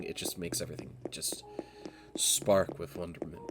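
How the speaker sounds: breathy dreamy whisper. Real, not acted. Talking about perfect lighting, dropping to a whisper. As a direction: hush it like a secret wonder.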